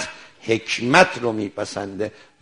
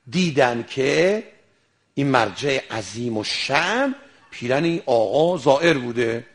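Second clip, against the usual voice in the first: usual speaking voice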